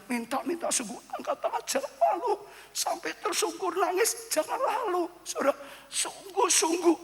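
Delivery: high, strained imitation of begging; tearful pleading. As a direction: act out crying in earnest prayer. Acting out tearful, earnest pleading. (meniru permohonan sambil menangis)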